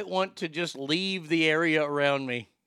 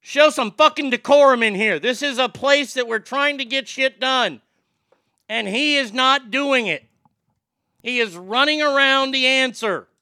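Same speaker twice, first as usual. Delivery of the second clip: loud angry scolding, pitched up; pretend angry rant; 7 semitones higher, 6 dB louder than usual. As the congressman scolding a colleague about decorum, loud and angry. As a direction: chew out the colleague. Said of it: "I go".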